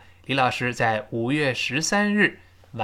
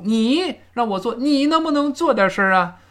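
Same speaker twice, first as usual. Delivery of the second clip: 你 and 做 stressed in a much higher voice; emphatic stress; 8 semitones higher than usual. Shown as a demonstration. Teacher likening English stress to Chinese, high emphatic 你 and 做. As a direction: push 你 and 做 up high. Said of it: "重读".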